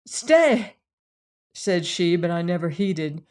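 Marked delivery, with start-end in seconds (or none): 0.0-0.8 high, louder urgent plea; calling after him. A narrator's reading. Joanna calling to the man leaving her, urgent plea. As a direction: call out to stop him.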